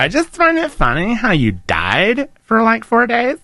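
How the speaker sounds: put-on slow, sing-song Valley Girl drawl. Shown as a demonstration. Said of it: "you gotta do it in a Valley Girl voice".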